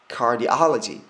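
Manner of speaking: "cardiology" slowly, the O loud as "ah"; stress on O. Shown as a demonstration. Teacher drilling the term for students, stressed O. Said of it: "여기가 강세를 받는 부분입니다".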